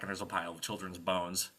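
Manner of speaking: ordinary delivery, nothing marked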